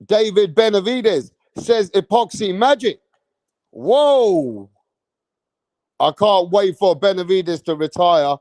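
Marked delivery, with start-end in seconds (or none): 3.7-4.7 drawn-out falling whoa; a long astonished whoa; surprised whoa. Real, not acted. Reacting to chat comment.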